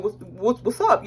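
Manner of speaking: mocking an ex in a lightly put-on guy voice; imitating a dude. Mocking her ex, lightly put-on dude voice. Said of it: "when I talk like a dude".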